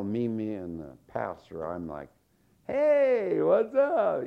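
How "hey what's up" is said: high, loud, upbeat hello; chirpy greeting. Retold or imitated. Pastor cheering up a scolded child, chirpy greeting. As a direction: greet a sulky kid with exaggerated cheer.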